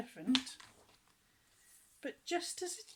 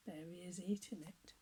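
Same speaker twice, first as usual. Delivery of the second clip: soft whisper; whispered aside; 3 semitones lower, 8 dB quieter than usual. Real, not acted. Reader whispers to the child about the picture.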